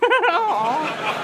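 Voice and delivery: bursting high laugh; amused rising laugh. Acted. Detective laughs at Hector.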